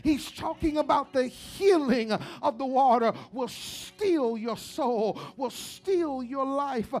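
loud rising preacher's call, chanting the lines; full-force preaching. Acted. Pastor preaching to his congregation, building loud. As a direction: preach it hard over the organ.

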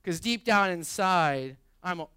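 loud, high, driving sermon voice; preaching at full force. Acted. Pastor urging congregation to pursue wisdom, full-force preaching. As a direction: preach it hard to the room.

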